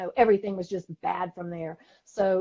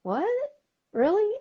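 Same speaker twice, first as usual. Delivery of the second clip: surprised; 14 semitones higher than usual. Retold or imitated. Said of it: "And I'm like".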